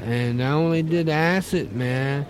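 stoned hippie voice. Acted.

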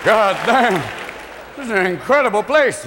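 ordinary talk, nothing marked

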